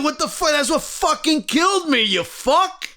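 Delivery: high, sing-song indignant complaint; mock the betrayal with a high squeal; hammed-up wounded indignation; outraged pet owner. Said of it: "you'd be like".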